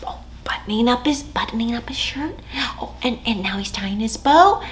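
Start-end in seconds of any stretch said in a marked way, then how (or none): 0.1-2.4 hushed quick whisper. Acted. Narrating the bear dressing to kids in a whisper. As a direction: whisper it like a secret.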